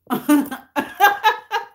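laughing out loud, caught off guard; can't hold back a laugh; real burst of laughter. Real, not acted. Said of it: "I shouldn't be laughing".

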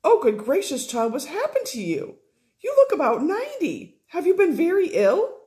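put-on aghast older lady, voice climbing high; shocked Fanny. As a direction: gasp over her stepdaughter's changed looks.

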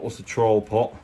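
normal talking voice

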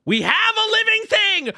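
high, loud shout of discovery; thrilled exclaim. Real, not acted. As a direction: announce it like a breakthrough.

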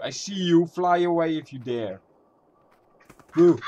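loud defiant taunting; fear turning to anger. Real, not acted. Taunting a scary bird in game.

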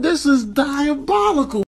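ordinary talk

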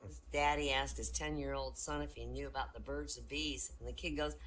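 ordinary delivery

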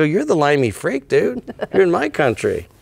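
high-pitched mock-indignant retort; indignant comeback. Canadian son answering his British dad back, mock-indignant. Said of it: "I go".

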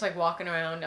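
ordinary delivery

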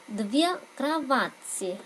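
Две кровати drawn out slowly; teacher models Две кровати slowly for learners; slow model phrase. Shown as a demonstration. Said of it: "cách hai số ít".

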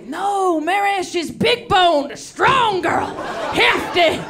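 hearty shouting doctor. Retold or imitated. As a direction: boom it out like a jolly country doctor. Doctor's booming verdict.

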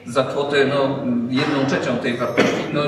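ordinary talk

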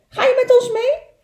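high, loud small-animal question; little beaver voice. Acted. Little beaver inviting the otter into the boat, high loud voice.

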